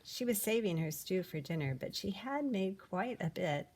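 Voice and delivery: ordinary delivery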